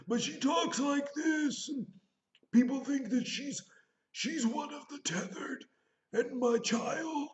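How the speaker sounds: high, slow, strained creepy voice; imitating Lupita's evil character. Reviewing the movie, imitating the villain's creepy high voice. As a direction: rasp it out high and eerie, like a haunted double. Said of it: "she kinda talks like that".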